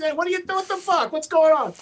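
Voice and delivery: lightly voiced Dice, cheery; Dice's greeting. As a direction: greet a pal heartily. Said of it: "Dice greeting him".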